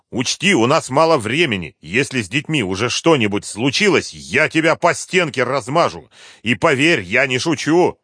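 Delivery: threatening colonel. A narrator's reading. Colonel warns the captive he is not joking, threatening. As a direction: warn him forcefully, in deadly earnest.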